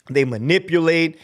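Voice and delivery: ordinary delivery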